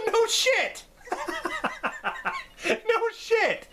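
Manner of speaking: high laugh through the words; cracking up while talking. Real, not acted. Laughing at the movie scene to viewers, high laughing voice.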